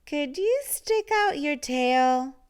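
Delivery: plain reading voice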